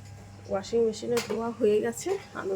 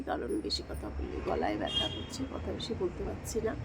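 hushed voice, saving a sore throat; speaking softly; 3 semitones lower, 8 dB quieter than usual. Real, not acted. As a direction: talk low because it hurts to speak. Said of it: "খুব আস্তে কথা বলছি".

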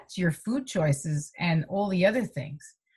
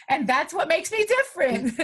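laughing through the line, a little higher; 9 semitones higher than usual. Real, not acted. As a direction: chuckle it out proudly. Laughing at her own point.

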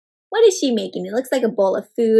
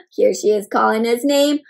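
ordinary delivery, nothing marked

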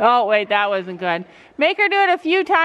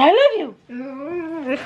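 very slow, drawn-out coaxing; coaxing dog; 3 semitones higher, much slower than usual. Shown as a demonstration. Coaxing Ruby to say I love you, very slow. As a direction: stretch each word for the dog.

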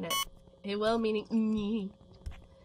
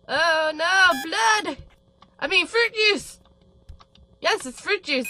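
high, loud, flustered cry; blurting out a panicked correction; frantic high-pitched backpedalling; panicked exclaim; 8 semitones higher, 7 dB louder, slower than usual. Acted. Panicked cover-up about blood.

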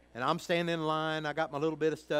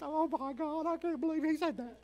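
much higher, mock-scandalized mother; shocked mama. Son imitating his red-faced mama hearing him, high mock shock. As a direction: squeal it like a shocked church mom. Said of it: "My mama right now, she's so red-faced".

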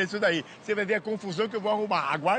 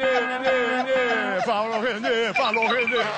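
calling out; 4 dB louder, much slower than usual. Acted. Calling name slowly.

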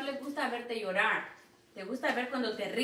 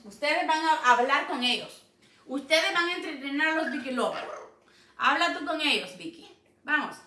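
normal talking voice

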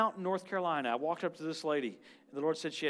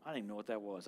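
ordinary talk

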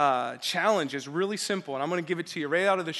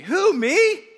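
disbelieving, pitch shooting up; can't believe it's you he means; mock disbelief, question leaping high; incredulous; 12 semitones higher, 9 dB louder than usual. Shown as a demonstration. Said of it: "a little more incredulously".

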